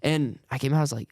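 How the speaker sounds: ordinary delivery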